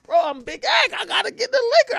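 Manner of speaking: urgent demand. Retold or imitated. Clubber demanding liquor before closing, urgent high plea. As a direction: plead loudly for a drink.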